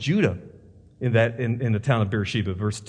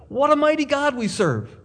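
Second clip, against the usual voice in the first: pitched higher, more fervent; rising fervor; 10 semitones higher than usual. Real, not acted. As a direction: lift it up in praise. Preacher repeats, higher.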